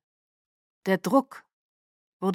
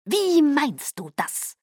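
slow, higher, cold-voiced Caius; icy whisper; 7 semitones higher, slower than usual. A narrator's reading. Caius questioning Irina in an icy hushed tone. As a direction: chill every word, slowly. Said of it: "flüsterte Caius eisig".

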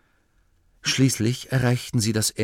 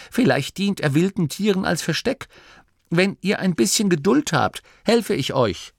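high, raised voice calling instructions down; herabrufend; 10 semitones higher than usual. Acted. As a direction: guide them from up high. Masklin ruft von oben herunter. (mit hoher Stimme von oben rufend)

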